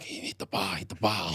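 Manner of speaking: hissed whisper of a coach; Desi's voice. Retold or imitated. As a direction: urge it in a tense whisper. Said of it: "But in Desi".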